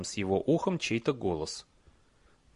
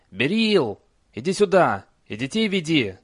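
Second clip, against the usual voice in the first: loud, high call; 6 semitones higher, 6 dB louder than usual. Acted. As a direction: call down to the family. Calling wife.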